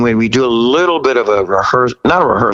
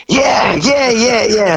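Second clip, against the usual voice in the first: put-on overexcited kid; 9 semitones higher, slower than usual. Young singer answering the TV host, high and giddy. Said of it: "I'm like such a kid".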